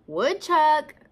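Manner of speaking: high, loud call to a friend. A narrator's reading. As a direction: call out the name up the hill. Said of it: "called Turtle".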